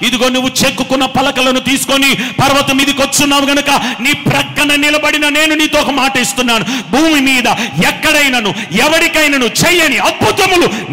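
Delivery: loud, proclaiming promise; God vowing wonders to the believer, loud. Acted. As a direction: speak God's vow with force. God's promise voiced.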